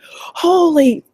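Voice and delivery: high, loud, drawn-out stunned exclamation; expressive astonishment. Retold or imitated. Coach retelling the big guy's reaction to her page, high exaggerated astonishment. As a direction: act out an American guy's over-the-top amazement. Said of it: "emotive ... Expressive, probably".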